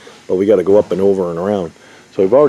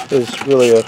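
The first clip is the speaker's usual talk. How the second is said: usual speaking voice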